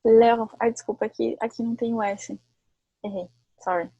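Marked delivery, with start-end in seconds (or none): 0.0-0.5 "leur" said as French singular, no S; singular "leur" in a French lesson. Shown as a demonstration. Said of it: "Ah, mas o leur, leur, então, aqui singular é sem o R".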